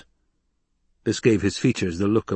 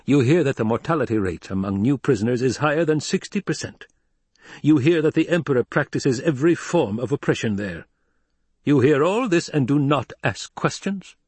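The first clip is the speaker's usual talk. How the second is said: raised, insistent questioning; incredulous challenge; 6 semitones higher than usual. Acted. Hawat challenges the Baron's lack of curiosity, incredulous. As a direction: pile up the charges, higher pitched.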